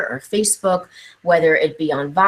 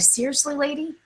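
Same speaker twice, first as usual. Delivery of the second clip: much higher, softer, incredulous whine; a kid fed up with repeating; exasperated disbelief; 8 semitones higher, 7 dB quieter than usual. Retold or imitated. Student to teacher fed up with repeating, incredulous higher whine.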